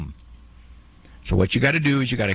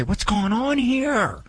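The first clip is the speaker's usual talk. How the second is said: bewildered parents; 10 semitones higher than usual. Retold or imitated. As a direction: voice baffled parents, pitched well up. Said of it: "when parents look at their child and say".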